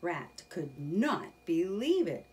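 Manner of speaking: ordinary delivery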